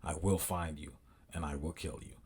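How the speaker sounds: low, very soft menacing monotone; movie threat imitation. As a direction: quote it like a quiet, deadly phone threat. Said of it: "you see the movie Taken?".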